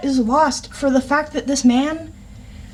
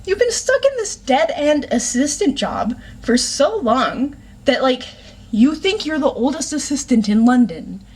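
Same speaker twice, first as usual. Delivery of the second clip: exasperated sass; 3 semitones higher than usual. Retold or imitated. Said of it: "I'm reading that line going".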